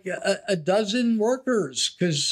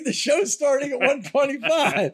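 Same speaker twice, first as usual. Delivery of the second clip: carnival barker; 4 semitones higher than usual. Retold or imitated. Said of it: "carnival barker voice".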